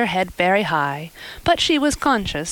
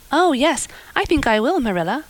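optimistic; 6 semitones higher than usual. A narrator's reading. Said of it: "returned Anne optimistically".

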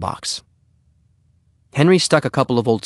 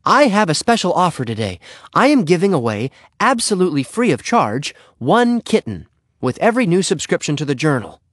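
high, emphatic salesman patter; Henry's sales pitch; 7 semitones higher, 3 dB louder than usual. Acted. Henry pitches kittens at the door, high and emphatic. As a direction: announce the offer with punched phrases.

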